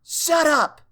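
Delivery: loud, high furious scream. Acted. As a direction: scream it with everything. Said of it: "Starlight screamed".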